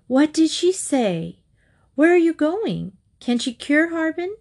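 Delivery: high-pitched, insistent string of questions; eager questioning. A narrator's reading. Wife quizzing husband after his phone call, eagerly questioning. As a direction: pitch it up, pressing for news.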